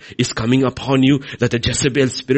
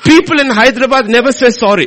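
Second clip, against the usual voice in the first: laughing through the words, louder; 9 semitones higher, 8 dB louder than usual. Real, not acted. Preacher joking to congregation about locals, laughing. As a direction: chuckle out the joke.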